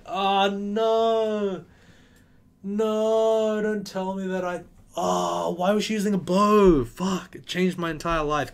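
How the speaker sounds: high, loud dramatic despair; panicked wail. Real, not acted. Realizes he fucked up.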